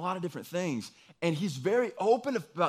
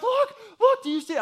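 urgent wife; 8 dB louder than usual. Retold or imitated. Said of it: "she's like".

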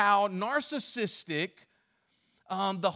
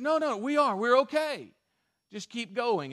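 insistent pastor; 5 semitones higher, 4 dB louder than usual. Retold or imitated.

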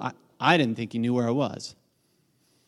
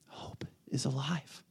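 slow, breathy whisper, alive rising; 12 dB quieter, slower than usual. Real, not acted. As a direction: let the words hang in a whisper. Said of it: "but hope is alive".

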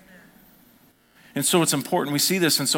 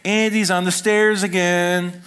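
high, loud, slow sing-song tattle; a kid telling on him, drawn out; tattling kid; 6 semitones higher, 5 dB louder, slower than usual. Retold or imitated. Party kid tattling to the grown-ups, loud sing-song.

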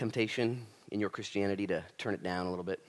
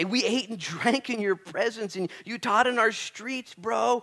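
slow, higher pleading of the shut-out people; pleading crowd; 9 semitones higher, 4 dB louder, slower than usual. Acted. Shut-out people begging the master at the door, higher and slow.

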